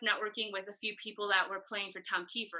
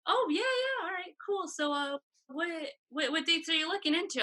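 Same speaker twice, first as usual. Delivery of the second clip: startled fake casual; 7 semitones higher than usual. Startled, acting casual on phone.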